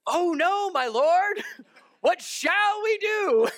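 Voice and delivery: high, loud, drawn-out mock wail; hammed-up panic. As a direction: overact a terrified servant, crying out with a laugh. Acts out servant seeing the army.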